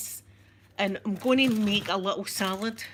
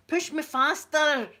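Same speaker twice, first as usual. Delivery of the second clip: high, loud, drawn-out mock command; hammed-up excited demand; playful calling out; 7 semitones higher, 6 dB louder, slower than usual. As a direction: call out as if on a joyride. Pretend wheelchair ride, urging the pusher.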